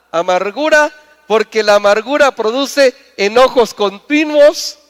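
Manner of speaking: loud, high, rising preacher's call; full-force preaching. Acted. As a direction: build it up and hammer each step home.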